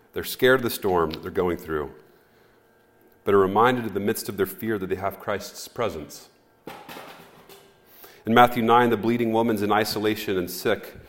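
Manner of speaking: ordinary delivery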